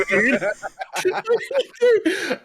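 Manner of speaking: can't get the words out for laughing; helpless laughter. Real, not acted. Telling friends about the Blockbuster punch, laughing high. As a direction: laugh breaking high into the word dude. Laughing at his own story.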